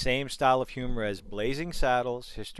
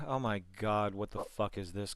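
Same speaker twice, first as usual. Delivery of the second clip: deadpan; 2 semitones lower, 5 dB quieter than usual. Shown as a demonstration. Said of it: "as compared to".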